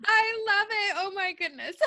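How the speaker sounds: high, squealed through laughter; real helpless laughter in the voice; laughing hard. Real, not acted. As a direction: say it while cracking up. Host still cracking up at joke.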